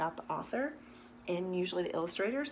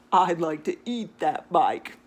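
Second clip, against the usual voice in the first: louder put-on goat voice; greedy goat voice; 3 semitones lower, 9 dB louder than usual. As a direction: growl it like a hungry goat. Goat's hungry thought.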